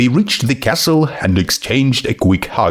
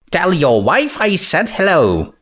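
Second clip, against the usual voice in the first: Twilight voice; 7 semitones higher than usual. Acted.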